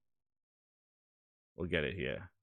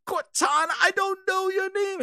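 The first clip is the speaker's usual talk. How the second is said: much higher, strained confused reply; mock distraught Chief. Master Chief answers the pleading ghost, high hammed-up distress. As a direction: ham up a lost hero's confusion.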